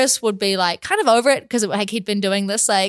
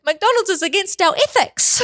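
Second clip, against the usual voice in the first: high mock-principled parroting, laughing; mimicking mum's line; 7 semitones higher than usual. Chris parroting mum all day, high mocking imitation. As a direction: parrot mum's verdict in a higher voice. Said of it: "Chris was like".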